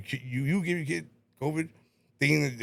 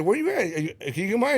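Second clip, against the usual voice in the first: high, louder questioning; retold urgent question; 6 semitones higher, 4 dB louder than usual. Worker retelling a call to his superintendent, urgent and high. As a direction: act out chasing someone up, pitched high.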